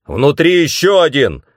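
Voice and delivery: loud, high warning cry; alarm shout. A narrator's reading. Intruder spots someone inside, alarm shout. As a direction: shout out to the others. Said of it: "крикнул кто-то".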